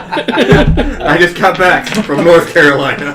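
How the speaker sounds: mock Southern. Son mimicking dad's Southern accent, laughing. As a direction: ham up a Southern drawl through laughter.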